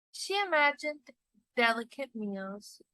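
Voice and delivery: ordinary delivery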